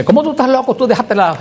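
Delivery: high-pitched mock outrage of a sister; retold indignant telling-off, voice jumping high; imitated scolding.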